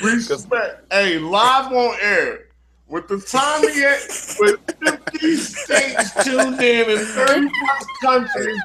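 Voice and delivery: high, loud whoops of amusement; laughing teasing. Real, not acted. Host teases guest for confessing live on air, laughing loudly. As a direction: crow it through laughter, stretching the words.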